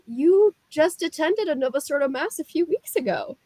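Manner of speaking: high, puzzled imitation of the husband; perplexed husband. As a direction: play the baffled husband, pitch well up. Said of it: "he's very perplexed".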